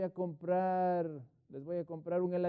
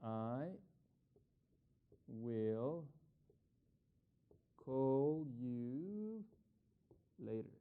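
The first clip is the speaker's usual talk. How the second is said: "I will call you later" with long gaps between the words; slow dictation; 2 semitones lower, 4 dB quieter, much slower than usual. Shown as a demonstration. Teacher showing students an offer, dictated word by word. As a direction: lay out each word slowly so they can write it.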